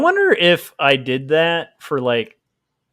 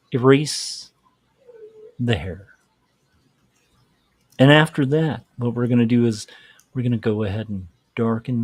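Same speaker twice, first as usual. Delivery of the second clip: NPR guy voice; 3 semitones lower than usual. Pretend. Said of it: "an N P R guy".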